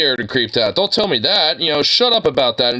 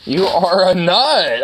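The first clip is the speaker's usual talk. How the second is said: higher, louder, slow and stretched; excited dog talk; 5 semitones higher, 3 dB louder, slower than usual. Real, not acted. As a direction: burst out delighted at the dog.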